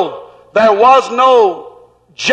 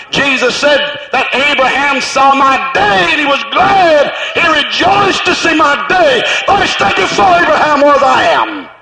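preaching at full force; 2 semitones higher than usual. Acted. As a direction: lift it up and proclaim it. Preaching swell.

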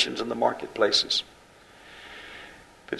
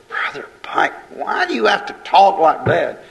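higher-pitched mock objection; complaining listener; 5 semitones higher than usual. Congregant challenging the preacher over his blunt talk, mock protest.

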